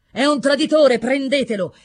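loud, high alarm; forceful battle shout; shouted accusation. A narrator's reading. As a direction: denounce him and order the capture. Knight denounces a traitor in battle.

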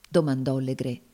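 plain reading voice

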